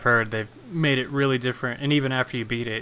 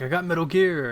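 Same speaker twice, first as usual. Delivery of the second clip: higher-pitched imitation of an excited gamer; mock excited; 5 semitones higher than usual. Excited guy showing off his game to a friend, mildly excited. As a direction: lightly act out his excitement. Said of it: "a guy's really excited".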